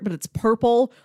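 ordinary delivery

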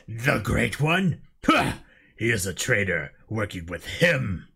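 low, mocking alien soldier; scornful elite. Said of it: "For elite voices".

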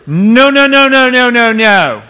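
high, loud, laughing no-no-no; sing-song rejection with a laugh; playful refusal. Retold or imitated. A dad rejecting his kid's fake sorry, laughing high no's.

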